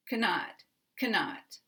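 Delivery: Cannot with a weak first syllable and strong NOT; stress on second syllable. Shown as a demonstration. Teacher showing the negative syllable is strong, canNOT. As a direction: reduce the can of Cannot, land on not. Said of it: "the first syllable is going to be weak".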